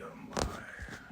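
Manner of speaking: hushed unvoiced whisper. Shown as a demonstration. Streamer whispering to viewers that he is live. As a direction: breathe it as a secret. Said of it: "whispered aside to viewers".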